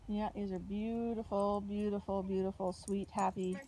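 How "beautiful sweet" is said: normal talking voice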